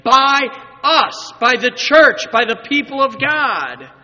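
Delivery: high, loud, emphatic preaching; loud preacher's proclamation, pitched high; preaching at full force. Real, not acted. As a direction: proclaim it to the whole congregation.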